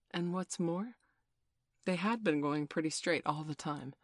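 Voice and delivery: ordinary delivery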